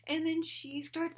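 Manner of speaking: normal talking voice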